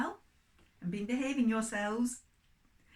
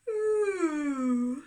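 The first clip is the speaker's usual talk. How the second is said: a high, louder rising yawn; 4 semitones higher, 7 dB louder than usual. Acted. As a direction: yawn big as Pig waking. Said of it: "Went Pig".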